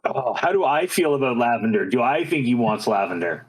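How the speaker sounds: normal talking voice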